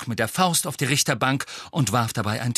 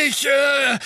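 louder, higher flustered rage; raging; 5 dB louder than usual. A narrator's reading. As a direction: splutter in a rage. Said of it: "tobte Fudge".

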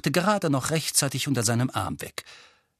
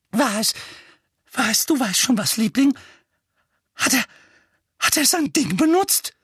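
high, frightened whispering mother; fearful mother; 12 semitones higher, 5 dB louder than usual. A narrator's reading. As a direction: ask in hushed terror. Petunia voiced.

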